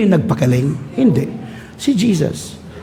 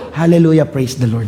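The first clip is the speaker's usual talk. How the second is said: ordinary talk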